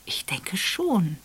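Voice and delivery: a slow, hesitant half-whisper; unsure. Acted. Esther answers Raphael's question unsure, slow and hushed. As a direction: trail off, not sure of herself. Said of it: "sagte sie unsicher".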